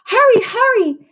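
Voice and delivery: put-on a frightened girl screaming for help; panicked scream. A narrator's reading. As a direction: shriek it down the phone in a panic. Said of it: "someone screamed".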